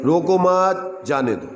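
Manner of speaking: रोको मत run together, मत stretched, pause, then जाने दो; stress on मत. Shown as a demonstration. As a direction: hold मत, break after it, drop on जाने दो. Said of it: "शब्द के पीछे जोर से बोल दो". (मत पर ज़ोर देकर विराम, फिर जाने दो)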